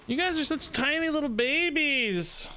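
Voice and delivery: high, slow teasing coo at the board; mock baby talk. Teasing opponents again, higher.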